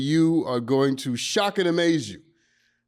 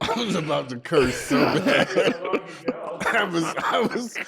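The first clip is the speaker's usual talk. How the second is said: laughing; 4 semitones higher, slower than usual. Real, not acted. Laughing after the joke.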